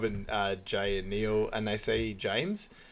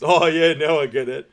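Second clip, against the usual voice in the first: loud, high burst of mock delight; belated realisation; 7 semitones higher, 12 dB louder than usual. [name] finally getting the cat joke, loud belated realisation. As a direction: exclaim it much louder, as if it just clicked. [name]'s late laugh at joke.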